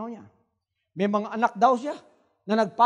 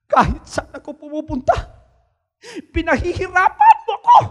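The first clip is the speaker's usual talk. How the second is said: the devil complaining; 6 semitones higher, 4 dB louder than usual. Retold or imitated. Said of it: "Sabi niya".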